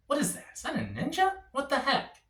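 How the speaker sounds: quietly squint at something far off; puzzled. Retold or imitated. Spotting a figure from his car, hushed and puzzled. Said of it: "And I was like".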